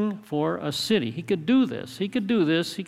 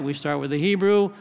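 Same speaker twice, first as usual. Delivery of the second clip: ordinary delivery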